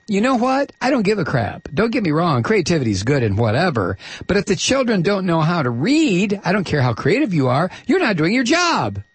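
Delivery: high-pitched, exasperated imitation of a blunt boss; mock dismissive retort. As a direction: scoff it out high, hammed-up impatience. Acts out Rhee's dismissive retort.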